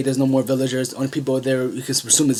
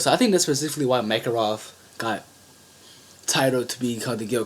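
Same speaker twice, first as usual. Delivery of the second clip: ordinary talk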